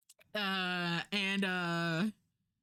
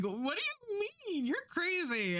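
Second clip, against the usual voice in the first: mock shocked disbelief; 11 semitones higher than usual. Her own retold reaction.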